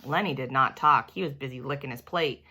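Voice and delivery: usual speaking voice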